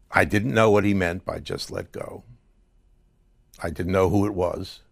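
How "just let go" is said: whispered quote. Retold or imitated. Quoting the dream voice again.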